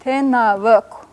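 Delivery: tenavuk slow by syllables, ending in a whispered vowel; whispered final vowel. Shown as a demonstration. Teacher gives two men, slow tenavuk with whispered ending. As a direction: spell out tenavuk slowly, last vowel voiceless. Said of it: "whispered vowel on the end".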